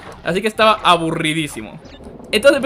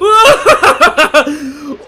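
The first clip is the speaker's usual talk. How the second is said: high, loud wailing outburst; loud drawn-out scream; 10 semitones higher, 8 dB louder than usual. Real, not acted. Streamer loses it in the game, long loud scream. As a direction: let out a long panicked scream.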